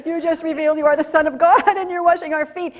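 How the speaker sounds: amused disbelief as the disciple; laughing disbelief. Retold or imitated. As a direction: disciple laughing in astonishment at Jesus. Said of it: "this guy ... who's like".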